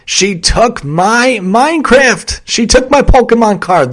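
put-on high, loud drawn-out kid whine; whiny child. Four-year-old complaining about a sibling, high whiny wail.

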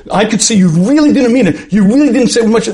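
acted wife's high, heated accusation; upset. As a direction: accuse and talk right over him. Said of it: "she gets upset and have a big fight".

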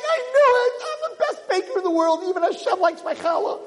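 pitched high, gleeful boasting; excited. Acted. Wife celebrating that God ate her challah, elated. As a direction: crow with joy over her baking. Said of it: "She's all excited".

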